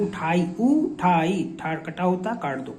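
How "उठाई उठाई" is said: उठाई, उठाई read slowly, every syllable clear; slow careful reading. Shown as a demonstration. Teacher modelling how pupils should read उठाई. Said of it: "आप अच्छे से पढ़ेंगे इसको".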